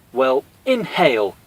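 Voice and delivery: slow high yell in a put-on voice. Cammy yelling at Honda to squeeze in, slow yell. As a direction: yell it at him. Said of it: "Cammy yelled".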